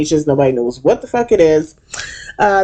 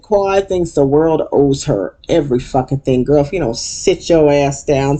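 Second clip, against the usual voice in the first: ordinary delivery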